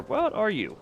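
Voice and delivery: ordinary talk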